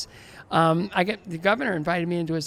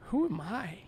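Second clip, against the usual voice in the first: soft, near-whispered wonder; hushed awe; 2 semitones higher, 5 dB quieter, slower than usual. Retold or imitated. Skater humbled among Paralympic athletes, hushed and softer. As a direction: let it fall to a humbled hush.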